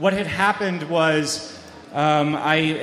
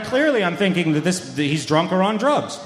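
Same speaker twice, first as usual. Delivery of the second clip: ordinary talk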